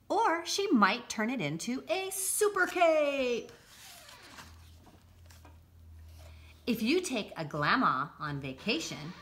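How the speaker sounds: lively picture-book read-aloud; bright, bouncy storybook narration; reading to children. Acted.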